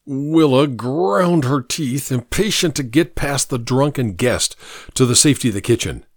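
usual speaking voice

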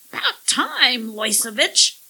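loud, high parrot squawk. A narrator's reading. Parrot Long John greets his alien boss, squawking. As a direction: squawk it out like an impatient bird. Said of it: "he squawked".

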